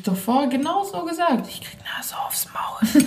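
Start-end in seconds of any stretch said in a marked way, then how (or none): 1.5-3.0 hushed whispered aside; unvoiced giggling whisper. Real, not acted. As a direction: whisper it, half laughing. Whispered aside between friends.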